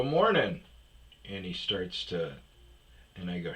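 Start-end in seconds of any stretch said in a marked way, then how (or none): 0.0-0.6 cheery greeting. Retold or imitated. Greeting old vet in hallway.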